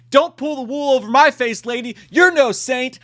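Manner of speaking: high, loud scolding; theatrical telling-off; mock accusation. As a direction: call her out in hammed-up indignation. Acted confrontation with a movie character.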